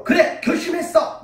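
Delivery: high, loud snap decision; eager resolve. Retold or imitated. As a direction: shout out a sudden decision. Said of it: "라고 이야기한 것이 아니라". (신나서 외치는 결심)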